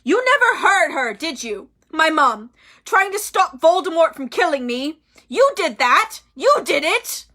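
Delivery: trembling furious accusation; shaking accusation. A narrator's reading. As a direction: throw the blame at him. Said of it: "His voice shook more than ever".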